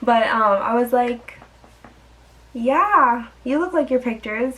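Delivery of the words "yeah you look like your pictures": a fake, higher agreeable yes you can hear through; insincere. To her date, mock-agreeing about his photos, plainly insincere. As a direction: agree without meaning a word of it. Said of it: "you could clearly tell I didn't really mean it".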